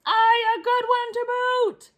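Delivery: very high, slow crew cheer; the whole crew agreeing; pirate crew. Acted. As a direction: crew echoing the captain. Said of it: "the others agreed".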